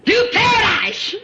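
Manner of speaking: very high, loud, slow cry; preacher lifting the crowd; preaching cry. Real, not acted. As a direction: soar on the word. Preaching climax.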